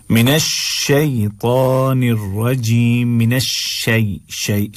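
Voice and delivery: slow "शैतानिर" with ay glide and heavy ta; correct shay glide. Shown as a demonstration. Teacher modelling recitation, "शैतानिर रजीम" slow with clear shay. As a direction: glide "शै" as shay, holding long vowels. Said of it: "शै नहीं पढ़ना है".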